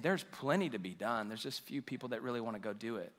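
low, very soft quoted words; hushed, understated voicing of Jesus; quiet observation. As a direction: drop right down as if thinking aloud. Voices Jesus looking at the harvest.

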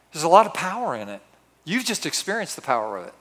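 ordinary talk, nothing marked

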